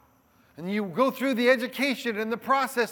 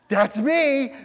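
eager bride; 4 semitones higher, 7 dB louder than usual. Retold or imitated. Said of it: "and she'll go".

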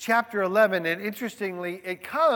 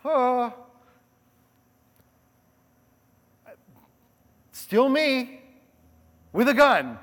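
new cop; 4 semitones higher, 6 dB louder, slower than usual. Retold or imitated. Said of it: "and you're like".

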